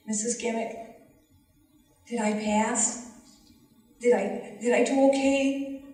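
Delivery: lightly voiced anxious child asking her teacher; soft, hesitant child.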